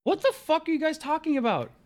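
very high, incredulous cry; completely lost, pitch shooting up; exasperated, high-pitched bewilderment; baffled outburst. Acted. Baffled by friends' jargon skit.

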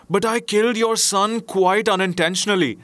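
high-pitched protest of a frightened man; imploring. A narrator's reading. Merchant explaining himself to the genius, imploring. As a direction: plead innocence, voice lifted. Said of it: "so I implore you".

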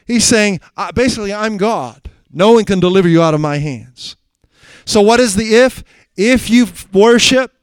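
fiery sermon delivery; preaching at full force. Acted. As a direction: thunder out the scripture. King's boast and the if.

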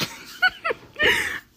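real burst of high laughter. Real, not acted. Telling listeners she wants to be darker, high laugh. Laughs about her beauty standard.

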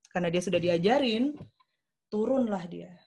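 ordinary delivery

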